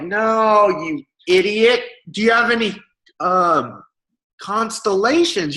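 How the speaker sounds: high, slow, condescending jeer; high, slow mock scold; mocking commenter. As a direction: talk down as if to an idiot. Mocking commenter berates the seller.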